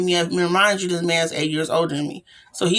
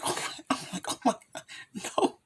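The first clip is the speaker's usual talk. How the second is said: breathless horrified whisper; terrified hushed gasp; panicked whisper; 3 semitones higher, 12 dB quieter than usual. Retold or imitated. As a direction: hush it out in sudden panic. Panicked reaction to Crazy C.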